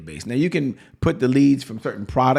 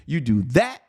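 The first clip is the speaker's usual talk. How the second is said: ordinary talk, nothing marked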